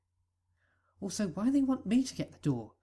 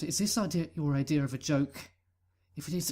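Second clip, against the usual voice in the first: usual speaking voice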